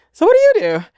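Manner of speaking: very high squeaky question; high-pitched prying. Retold or imitated. Asking the lady in the next seat, high prying squeal. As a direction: ask it way up high, playing the nosy seatmate.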